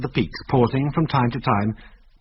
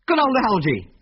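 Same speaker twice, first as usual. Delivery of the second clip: gleeful shout; 10 semitones higher than usual. A narrator's reading. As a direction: cheer as the plane appears. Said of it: "he shouted gleefully".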